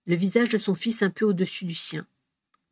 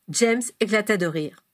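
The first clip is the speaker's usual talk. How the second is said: ordinary delivery, nothing marked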